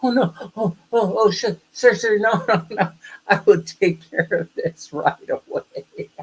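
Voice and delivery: high, flustered imitation of a panicked clerk; mock panic. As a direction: imitate her panic, high-pitched, half laughing. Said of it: "She panicked. She was like".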